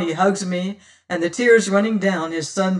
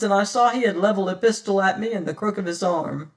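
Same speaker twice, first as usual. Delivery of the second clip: plain reading voice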